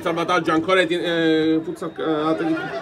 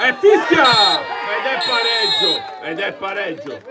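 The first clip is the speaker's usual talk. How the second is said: final whistle call; 3 semitones higher than usual. Real, not acted.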